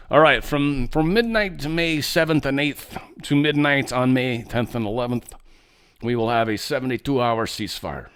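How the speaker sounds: put-on, silly Russian accent; hammed-up Russian accent. Commentator voicing Russia's leader announcing a ceasefire, silly Russian accent. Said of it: "always like to use that stupid accent".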